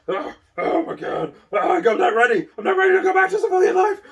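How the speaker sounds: high, loud, laughing mock panic. Soldier back from deployment, hammed-up laughing panic about civilian life. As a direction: squeal it out in half-laughing panic, fast at the end.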